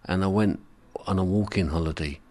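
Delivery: normal talking voice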